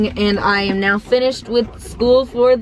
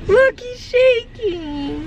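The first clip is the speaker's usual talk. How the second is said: slow cooing; 9 semitones higher, much slower than usual. Real, not acted. Cooing at shaking dog.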